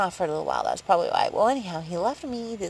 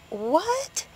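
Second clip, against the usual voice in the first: surprised what; 11 semitones higher than usual. Retold or imitated. Surprised at dinner invite.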